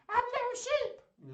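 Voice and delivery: very high, squeaky puppet voice; high-pitched toy sheep squeal; Little Sheep. Pretend. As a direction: pipe up as a tiny excitable sheep. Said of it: "in Little Sheep's voice".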